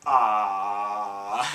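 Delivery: low, loud hammed-up "ah"; the watching kids gasping as their sister flies; mock gasp of alarm.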